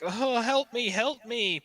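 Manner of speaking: high, desperate cry for help; high pleading cry. Acted. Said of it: "obviously in a booming dragon voice".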